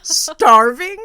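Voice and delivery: hammed-up hunger outburst; exaggerated complaint. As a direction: imitate a hungry friend complaining at full volume. Acting out hungry friend's complaint.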